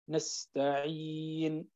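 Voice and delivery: slow and soft, the ي in نستعين held medium length; medium vowel length. Shown as a demonstration. Teacher comparing madd lengths on نستعين, soft slow. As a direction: hold the ي in نستعين for four counts. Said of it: "يجوز فيه أنك تقرأ حركتين، أربع حركات".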